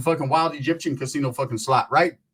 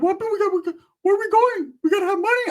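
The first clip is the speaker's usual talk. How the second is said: much higher, squeaky panicked friend; squeaky Ricky voice. Retold or imitated. Friend voiced high and squeaky asking where they're going. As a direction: squeak it out like a nervous kid.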